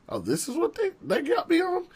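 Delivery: squeaky high mock amazement; a high squeal of wonder; mock disbelief. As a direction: play your naive younger self, wide-eyed. Mocking his younger self's pitch.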